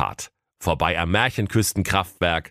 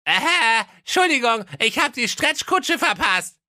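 high cartoon prince shouting his news; prince calling out. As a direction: blurt it in a high goofy voice. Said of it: "kam ... herein und rief".

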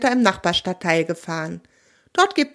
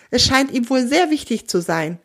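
usual speaking voice

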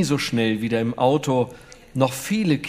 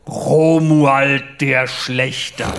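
very slow, solemn self-announcement; lightly voiced pompous knight, drawn out; grave knight voice; 5 dB louder, much slower than usual. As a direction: proclaim the name like a dark knight. Knight announces his name.

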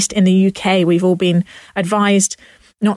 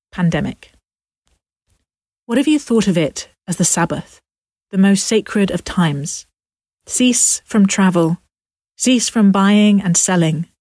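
poem reading. Acted. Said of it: "I'm gonna finish with a poem".

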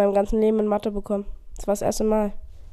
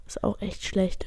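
whispering; 9 dB quieter, slower than usual. Real, not acted. Whispered dry verdict.

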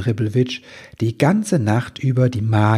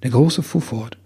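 usual speaking voice

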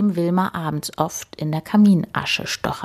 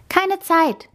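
much higher, quick call; hurried call; 12 semitones higher than usual. A narrator's reading. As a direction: toss it back, dashing off. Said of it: "rief sie".

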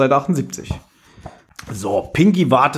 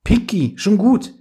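higher-pitched, startled reassurance; startled, hurry to reassure in a higher voice; 9 semitones higher than usual. A narrator's reading. Miss Murchison to tearful Pinky, startled. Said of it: "Miss Murchison erschrak".

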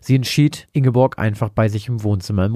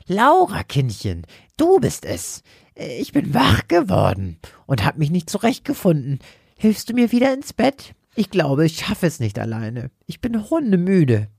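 put-on high, tired old lady asking for help; frail, weary grandma voice; frail old woman; 8 semitones higher than usual. Confused old woman asks for help.

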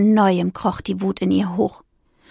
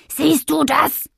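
higher, louder shout after the cyclist; rowdy yell; 7 semitones higher, 3 dB louder, slower than usual. Acted. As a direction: call it after him noisily. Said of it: "krakeelte sie ihm hinterher". (lautes Krakeelen hinterher)

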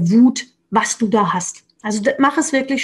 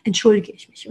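normal talking voice

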